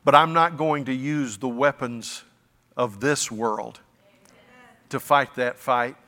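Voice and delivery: ordinary talk, nothing marked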